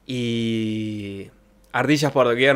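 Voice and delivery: ordinary delivery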